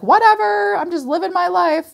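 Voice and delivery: high, breezy mock carefree voice; carefree brush-off. Mimicking a carefree person, high and airy. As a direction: toss it off like nothing matters. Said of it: "they're just like".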